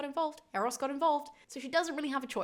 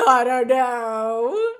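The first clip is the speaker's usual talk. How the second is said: drawn-out, louder wail as if face down in a mattress; muffled into mattress; 2 semitones higher, 12 dB louder, much slower than usual. Retold or imitated. Medea face down on her bed, slow, loud muffled wail. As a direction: moan it slowly into a pillow.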